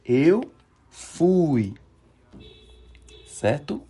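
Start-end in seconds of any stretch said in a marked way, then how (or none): 0.1-1.7 Eu and fui said again separately, firm and loud; a slow second model of Eu fui; repeated model. Shown as a demonstration. Teacher repeating the past tense for students, firm slow model. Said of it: "vai dizer como?".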